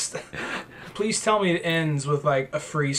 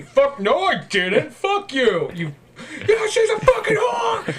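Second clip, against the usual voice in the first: high, hammed-up argument; mock angry denial; 11 semitones higher, slower than usual. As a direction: yell back like a caricatured hothead. Acted quarrel from the film.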